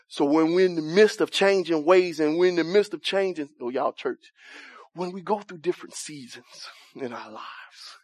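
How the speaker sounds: mock church-voice sermon phrasing; a hammed-up church preacher delivery; churchy preaching cadence. Shown as a demonstration. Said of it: "that how they say it in church?".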